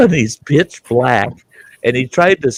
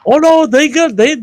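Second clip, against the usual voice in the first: much higher, louder put-on voice brushing it off; friend's breezy reply; 6 dB louder than usual. Friend shrugging off the cheap dentures, high and loud. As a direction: play the carefree friend who sees no problem. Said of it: "And I told him, I said".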